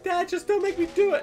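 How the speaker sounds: mock childish pleading with dad; pleading kid. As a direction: beg like a scared little boy. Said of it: "it's like".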